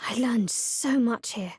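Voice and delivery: normal talking voice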